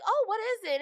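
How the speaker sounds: mock curious mom. Imitating the curious mom to her co-host, high pitched. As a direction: play the clueless mom, way up high.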